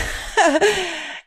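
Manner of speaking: a high breathy giggle; light laugh. Real, not acted. Guest joining the host's laughter, giggling. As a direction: giggle along.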